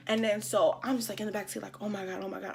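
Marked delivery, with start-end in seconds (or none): 1.8-2.5 hushed, low panic to oneself; retold fear. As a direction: murmur it like a frightened backseat passenger. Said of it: "afraid for my life".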